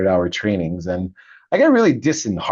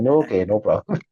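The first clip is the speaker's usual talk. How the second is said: lightly imitated Indian English; Indian accent. Quoting the monk urging him into the Ganges, Indian accent. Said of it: "This Indian accent".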